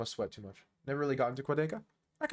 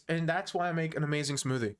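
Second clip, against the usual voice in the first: ordinary talk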